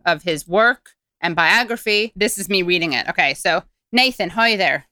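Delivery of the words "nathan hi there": mock Irish accent for an email. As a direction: put on an Irish lilt. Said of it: "doing this in an Irish accent".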